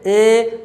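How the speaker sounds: "ए" held as a long ay; demonstrated vowel. Shown as a demonstration. Teacher showing the front vowel, demonstrated vowel. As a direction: sustain "ए" evenly for students to copy. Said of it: "देखिए ए".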